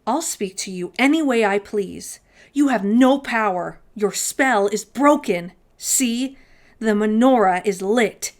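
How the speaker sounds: loud, defiant challenge. Acted. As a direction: stand up to the monster boldly. Defiant Herschel confronts the goblin.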